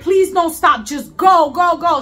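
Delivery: urgent plea. Retold or imitated. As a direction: re-enact hurrying the driver along. Urging driver not to stop.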